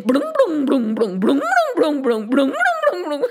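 bubbly, rolling "blum blum" bounces; bouncing-ball noise. Acted. Storyteller imitates Krishna bouncing the serpent, rolling blums. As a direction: bounce the voice up and down playfully. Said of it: "started bouncing him".

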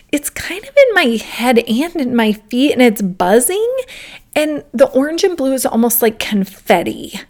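bubbly, imitated excitement; excited child. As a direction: voice a delighted son sharing news with mom. Voicing her delighted young son.

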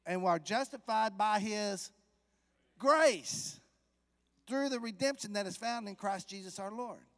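loud, high, ringing scripture; preaching at full force. Acted. Preacher proclaiming the rest of the verse. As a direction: lift grace up high and loud.

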